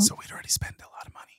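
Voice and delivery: fast, breath-only whisper; whispered aside. Real, not acted. Co-host on money already spent at the vet, whispered aside. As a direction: slip it in under your breath.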